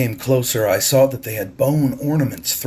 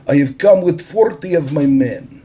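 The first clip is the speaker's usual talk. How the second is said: Russian captain; 6 semitones higher, 6 dB louder than usual. A narrator's reading. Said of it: "said the Russian".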